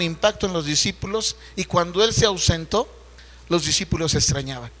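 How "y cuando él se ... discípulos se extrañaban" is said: normal talking voice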